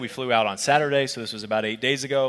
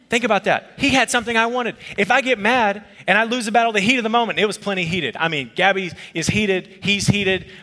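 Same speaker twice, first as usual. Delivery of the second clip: higher, louder preaching at full force; preaching build; 6 semitones higher, 4 dB louder than usual. Real, not acted. Pastor pressing the lesson on the congregation, preaching. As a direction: drive the point home to the congregation. Preacher driving home the point.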